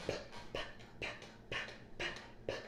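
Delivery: short puffed p sounds, breath only; penguin p sound. Shown as a demonstration. Mom shows her daughter the penguin p sound, puffing each p. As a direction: pop out each p like a little penguin. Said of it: "Put your arms like this and go".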